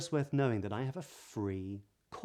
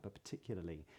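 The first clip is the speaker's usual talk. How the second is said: normal talking voice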